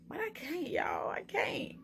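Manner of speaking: breathy whisper, barely there; whispered despair. Real, not acted. Vlogger admitting to viewers she can't, whispering. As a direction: whisper it like you're giving up. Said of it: "your girl a real deal scared".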